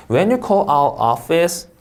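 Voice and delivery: slow read, rising on "office"; rising intonation. Shown as a demonstration. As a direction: carry the tune up through "office". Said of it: "하고 올려읽고". ("office" 끝을 올려 읽기)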